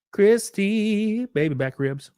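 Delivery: high, drawn-out call; playful name call. Real, not acted. Streamer greets the returning donor, high drawn-out call. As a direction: stretch the name out high, like a jingle.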